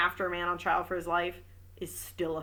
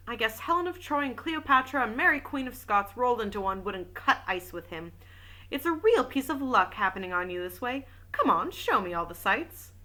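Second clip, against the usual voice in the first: even audiobook reading voice; story narration; 3 semitones higher than usual. A narrator's reading. Reading a detective novel aloud, steady narration. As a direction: read the novel aloud to listeners.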